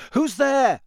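loud, high call into the dark. A narrator's reading. Harry calls to an unseen conjurer, calling out. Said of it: "he called again".

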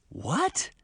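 high, rising disbelief; astonished question. A narrator's reading. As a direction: shoot the pitch up in surprise. Girls react in disbelief.

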